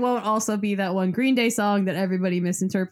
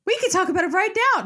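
excited; 10 semitones higher, 4 dB louder, much faster than usual. Acted. Excited mock eagerness.

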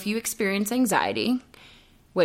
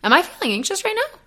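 much higher, startled double-take; sudden self-check; 9 semitones higher than usual. Retold or imitated. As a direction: catch yourself mid-thought, surprised. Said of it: "And I was like".

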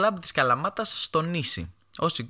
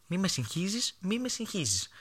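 high-pitched, softer mock exasperation; exasperated mother; 7 semitones higher, 5 dB quieter than usual. Mother denying the chicken is burnt, high exasperated voice. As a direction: imitate mum refusing to admit she is wrong. Imitates mother who never admits being wrong.

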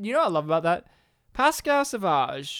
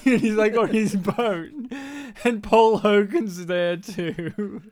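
normal talking voice

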